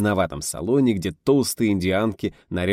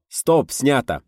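loud, higher, falling call; pleased shout; 7 semitones higher, 5 dB louder, much slower than usual. A narrator's reading. Pleased director ending the take, loud call. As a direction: call the wrap gladly. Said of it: "Довольный режиссёр крикнул".

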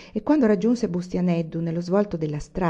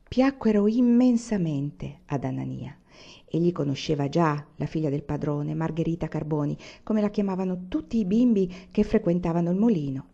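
plain reading voice

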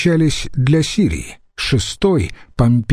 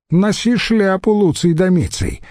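cheerful advice; 8 semitones higher than usual. Acted. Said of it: "весело посоветовал Курион".

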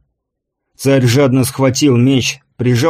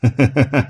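short chuckling laugh; 3 semitones higher than usual. A narrator's reading. Envoy amused by the king's words, a short laugh. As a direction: laugh in quick, even bursts. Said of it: "засмеялся".

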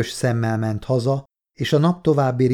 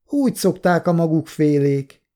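pointedly disdainful, pitched up; 6 semitones higher than usual. A narrator's reading. Official slights the complainant, disdainful higher-pitched tone. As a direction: put the person down with a higher-pitched jab. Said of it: "Kikérem magamnak ezt a hangot".

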